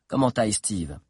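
ordinary delivery, nothing marked

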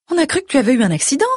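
high, fast worried-mother voice; anxious mother. A narrator's reading. Mother frets at her daughter.

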